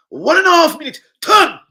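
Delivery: high, loud hammed-up chef call; mock chef. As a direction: bark the timing like a crazed chef. Mock chef timing the steak.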